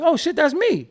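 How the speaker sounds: high, loud burst of surprise; retold thrill of hearing yourself; excited recognition. Retells recognising himself on album.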